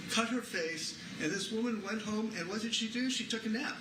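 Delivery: ordinary talk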